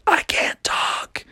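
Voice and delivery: silent high whisper, slow; mouthed. Acted. Voiceless princess mouthing to Froggy, whispered. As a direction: mouth the words with barely any voice. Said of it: "the other one mouthed".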